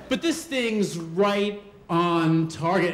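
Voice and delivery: ordinary delivery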